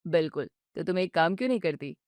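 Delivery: calm her down patiently; soothing husband. Acted. Husband soothes his upset wife, low and gentle.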